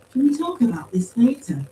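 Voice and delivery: high-pitched, mildly pleading question. A narrator's reading. Paz begs to postpone the discussion, pleading. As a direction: plead to put it off. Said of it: "Paz pleaded".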